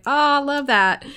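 acted-out sweet delight; delighted reply. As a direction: gush as if thanking someone for a compliment. Acts out delighted reply to compliments.

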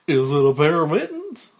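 put-on loud kids' show host guessing game; cartoonish mailman. Mike studies the gift, loud cartoonish mailman voice. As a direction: ask big, with exaggerated wondering. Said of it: "at a cartoonish Mike the Mailman".

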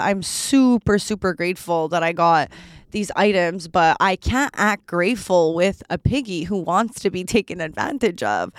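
usual speaking voice

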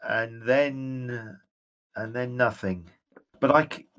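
hesitant, haunted memories; a dazed ghost struggling to remember; ghostly general, troubled. Acted. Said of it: "And he says".

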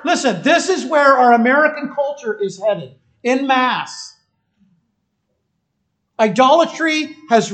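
loud, high, driving sermon voice; full-force preaching. Acted. Pastor rousing the congregation, preaching at full force. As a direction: preach it out with fire to the back row.

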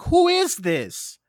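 mock amazement at a young film buff; amazed. Host marvels at an eighteen-year-old critic, slow and amazed. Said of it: "I'm like".

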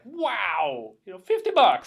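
hammed-up excited cabbie, high and loud; imitating an overexcited cab driver; super excited. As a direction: burst out in mock amazement. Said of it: "He's, like, super excited".

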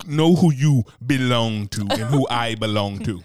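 slow, loud, teasing claim; playful possessive. Pretend. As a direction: tease her about whose she is.

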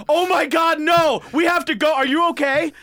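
high, loud mock-frantic shouting; panicked. Friend to injured player, panicked yelling. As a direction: imitate a friend frantic with alarm. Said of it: "panicked. She's like".

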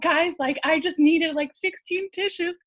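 high-pitched retold weepy plea; lightly hammed-up weepy exaggeration, pitched high; mock tearful. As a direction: imitate yourself overcome after crying. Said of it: "And I was just sobbing".

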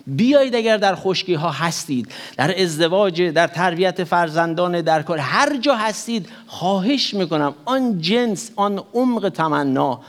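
usual speaking voice

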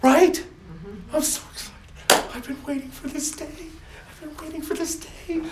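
high, loud thrilled urging; bursting with eager joy; excited God. Acted. God urging the angels on, thrilled high voice.

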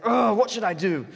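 loud, exasperated mock groan; hammed-up royal exasperation; raging king. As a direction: vent loudly as the fuming king. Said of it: "the king got up and in rage".

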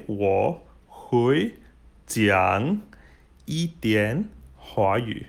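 slow, word by word, with gaps; slow model phrase. Shown as a demonstration. Teacher models a Mandarin sentence for beginners, slow and separated. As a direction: space out each word for learners to copy.

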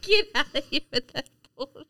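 laughing through the words. Real, not acted. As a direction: repeat it while cracking up. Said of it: "I'm laughing so hard".

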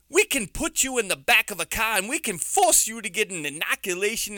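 a bit of a loud New York accent. Shown as a demonstration. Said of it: "that's my New York accent".

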